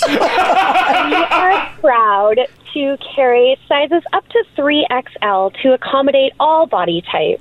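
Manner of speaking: slow, laughing customer-service voice; sweet receptionist, slowly and smiling; phone voice. Shown as a demonstration. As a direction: [name] pitches pajama sizes in her phone voice. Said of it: "I'll do it in the phone voice".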